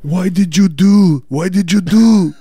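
high, loud, drawn-out mock Italian accent. Upset man over the smashed bodies, bad Italian accent. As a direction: lament it slowly in a hammy Italian accent. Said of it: "That's my Italian accent".

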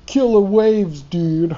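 mock Californian surfer talk; surfer impression. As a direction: play a beach dude on purpose. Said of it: "Tried to say something sounded American".

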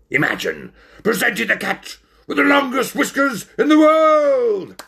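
high, building announcer's excitement; carried away. Acted. Ringmaster dreaming of his new star, carried away and loud. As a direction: build to a loud, soaring showman's climax. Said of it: "getting quite carried away".

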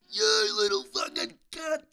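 high, strained screaming rage, drawn out slowly; imitated screaming. Red-faced driver screaming at the yellow car, imitated furious scream. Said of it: "like, screaming at me".

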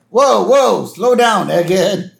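high, loud cartoon interruption; Wiggum. Host as Wiggum interrupting the professor, mock-alarmed. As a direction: halt the lecture with a high mock protest.

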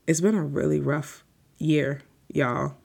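usual speaking voice

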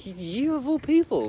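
high-pitched, wounded disbelief; disgusted disbelief. Retold or imitated. Son reacting to his doubting dad, disgusted disbelief. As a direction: retell it as a betrayed protest.

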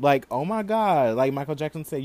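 ordinary talk, nothing marked

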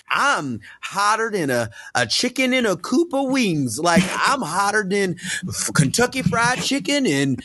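exaggerated country-bumpkin Southern drawl, high and slow; [name]'s Southern accent. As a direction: stretch it out in a mock Southern twang. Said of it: "[name] in this accent".

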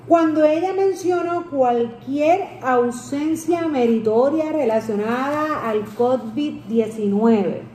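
slow, deliberate wording of a key phrase; deliberate emphasis. Real, not acted. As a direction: spell out the question slowly, word by word. Slow emphatic question after reading quote.

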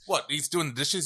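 ordinary talk

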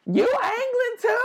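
high, slow squeaky mock question; mocking girl asking. Sarah mocked at the festival in a slow high squeak. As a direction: stretch it out in a silly high voice. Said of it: "she was like".

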